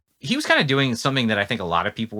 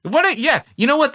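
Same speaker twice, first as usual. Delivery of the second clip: normal talking voice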